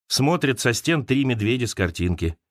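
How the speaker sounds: ordinary delivery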